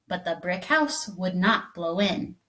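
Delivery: usual speaking voice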